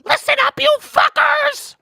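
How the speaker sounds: squeaky high rodent voice, loud; rally the troops as a tiny rodent; guinea pig voice. Pretend. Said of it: "That's a really good guinea pig voice".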